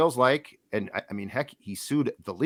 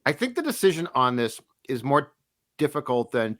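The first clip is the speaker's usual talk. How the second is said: ordinary talk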